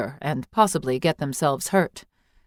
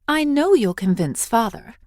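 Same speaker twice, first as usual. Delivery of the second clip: put-on higher girlish voice, coaxing; hopeful young girl; 6 semitones higher than usual. Eily sure Ninny Ma will win over father, girlish hope. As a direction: sweet-talk her into agreeing.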